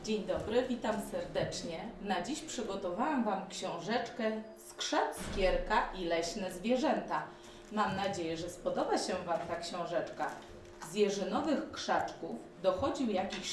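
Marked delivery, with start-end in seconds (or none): none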